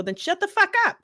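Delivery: mock retort. Retold snapping at classmate.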